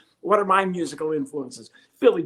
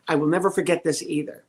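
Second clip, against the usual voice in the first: ordinary talk, nothing marked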